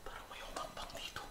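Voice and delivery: hushed unvoiced whisper; whispering while hiding. Real, not acted. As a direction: whisper so nothing hears you.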